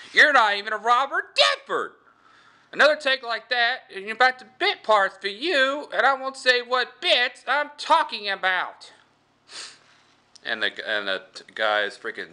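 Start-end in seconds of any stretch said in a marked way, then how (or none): 0.1-8.9 high, loud, screeching director; Crypt Keeper. Acted. Director threatening his actor with bit parts, screeching rant. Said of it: "is director, and he goes".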